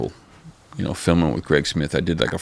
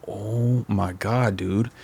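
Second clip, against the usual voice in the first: stunned; 2 semitones higher, 5 dB quieter, much slower than usual. Retold or imitated. Stunned after the meeting.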